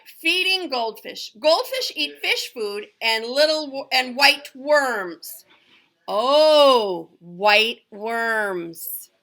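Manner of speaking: put-on clear, careful read-aloud for young kids; reading to children. Teacher reading a goldfish book to her class, in a clear storytime voice.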